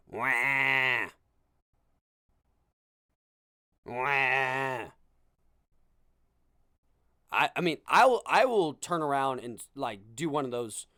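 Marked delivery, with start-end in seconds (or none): 0.1-4.9 imitated baby cry. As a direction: wail like a fussy infant in the seats. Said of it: "you hear".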